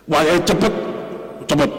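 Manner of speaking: short, quick words, voice lifted; brisk demo of "cepet". Shown as a demonstration. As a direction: say "cepet" quickly, rising at the end. Demonstrates camel told to go fast.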